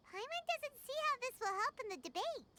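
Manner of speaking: high, bright little companion voice; high Paimon voice. Acted. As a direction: pitch way up as Paimon. Voicing Paimon's lines.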